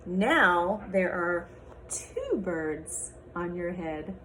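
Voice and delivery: lively picture-book read-aloud with character voices; reading to children. Acted. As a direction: read it to a class of little kids.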